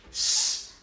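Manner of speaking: a long unvoiced s hiss. Shown as a demonstration. Teacher hissing the S sound for students. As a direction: hiss the letter S on its own. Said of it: "You have the sound s twice".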